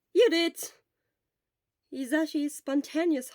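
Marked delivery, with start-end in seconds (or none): 0.1-0.7 loud, bright agreement; eager exclamation. Acted. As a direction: blurt it out happily. Hisashi exclaims.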